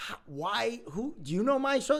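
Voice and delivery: a nurse who can't believe it; baffled nurse. Retold or imitated. Nurse baffled by the social number.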